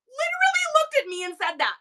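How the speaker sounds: high, loud disbelief at his nerve; incredulous outrage. Real, not acted. Telling viewers about the rude reseller, in loud, high disbelief. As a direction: squeak it out, stunned he said that.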